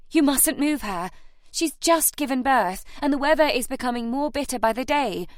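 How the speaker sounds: high, loud, agitated lady; distressed, insistent objection; urgent protest. A narrator's reading. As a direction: plead urgently for the animals. She pleads for the animals.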